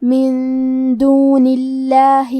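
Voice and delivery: "من دون اللہ" chanted slowly with a thin, light L in "اللہ"; level tajweed chant, light L in "اللہ"; thin lam demo. Shown as a demonstration. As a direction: keep the lam of "اللہ" light, drawing the phrase out. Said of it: "پتلی آواز میں پڑھنا ہے".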